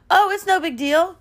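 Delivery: high, nonchalant brush-off; imitated breezy man. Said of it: "He's like".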